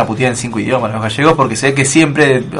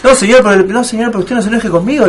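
placating agent; 7 semitones higher than usual. Retold or imitated. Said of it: "le decía yo".

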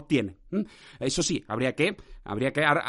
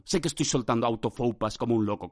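ordinary delivery

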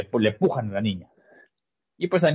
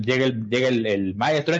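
ordinary talk, nothing marked

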